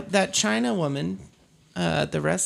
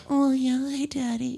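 higher coy breathy greeting; flirty lady voice; 9 semitones higher, 4 dB quieter than usual. Shown as a demonstration. Showing off her lady voice to friends, a high coy flirt. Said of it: "I like to do the".

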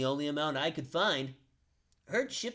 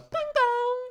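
high sung ding dong; doorbell imitation; 6 dB louder than usual. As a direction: chime it like a doorbell.

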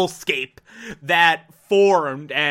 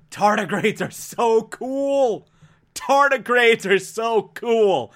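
slow, higher-pitched fan gushing; gushing excitement; 4 semitones higher, slower than usual. Real, not acted. Host raving about tardigrades, gushing excitement. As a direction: draw it out in real delight. Gushing over favourite animal.

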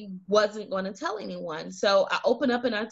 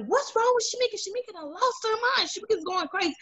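mocking kids; 11 semitones higher, much faster than usual. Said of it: "they were like".

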